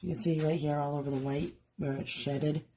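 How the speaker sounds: usual speaking voice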